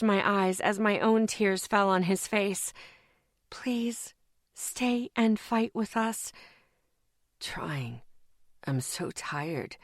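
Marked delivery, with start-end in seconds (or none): 3.5-6.3 soft, slow whispered plea; a softer, slower tearful whisper; pleading whisper. Acted. Pleading with dying friend. 7.4-9.8 low, very soft slow whisper; a lower, much softer worn-out whisper; exhausted whisper. Acted. Exhausted Isaac answering.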